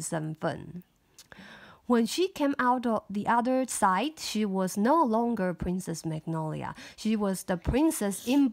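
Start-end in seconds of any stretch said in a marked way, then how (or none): none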